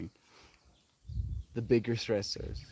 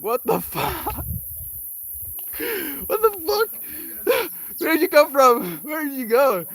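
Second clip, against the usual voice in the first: stunned disbelief; 5 dB louder than usual. Retold or imitated. Tripping speaker shocked the inner voice vanished, high baffled cry. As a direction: reel back, baffled and laughing.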